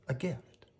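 plain reading voice

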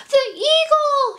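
very high, loud cry of alarm. A narrator's reading. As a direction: cry out a shrieked warning. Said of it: "she cried".

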